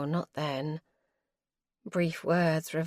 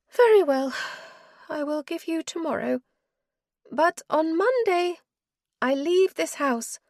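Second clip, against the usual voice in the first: higher-voiced wife, cold and weary; wife's voice; 11 semitones higher, 3 dB louder than usual. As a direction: she refuses him, tired and final. Wife's voice again.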